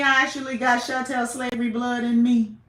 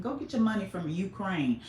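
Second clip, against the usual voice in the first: ordinary talk, nothing marked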